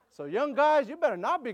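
calling out. Real, not acted. Calling out to congregation.

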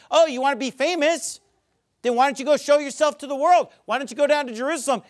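high-pitched taunting challenge; retold scoffing, mock-innocent goading. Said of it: "making fun of him, mocking him".

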